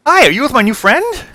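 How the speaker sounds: high, loud, bubbly gnome greeting; eager gnome. Acted. Gnome wizard greets the party, high and overeager. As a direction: chirp it out like an overexcited little gnome.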